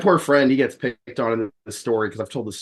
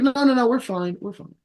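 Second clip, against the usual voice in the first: much higher quick no no no, fading; mock hasty refusal; 13 semitones higher than usual. Friend refusing help again, higher hasty refusal. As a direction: cut the offer off fast.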